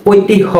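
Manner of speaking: ঐতিহ্য misread as "oitih-ho"; student mispronunciation. Shown as a demonstration. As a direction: read ঐতিহ্য with an h where the j belongs. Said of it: "অনেক student ভুল করে থাকে".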